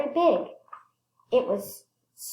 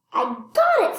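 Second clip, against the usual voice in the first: high and louder, cheering; triumphant; 11 semitones higher, 5 dB louder than usual. Acted. Clark catches a prairie dog, triumphant. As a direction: shout the win.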